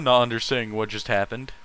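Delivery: ordinary talk, nothing marked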